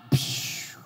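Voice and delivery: hushed voiceless splat sound; airy "psh" of impact; impact noise. Acted. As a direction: mimic a crash with rushing breath. Imitates the body hitting ground.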